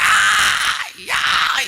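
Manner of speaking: high, loud imitated wail of pain; mock wailing cry. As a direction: wail like a tormented woman. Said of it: "una señora decía". (imitando el lamento agudo y fuerte de una señora atormentada)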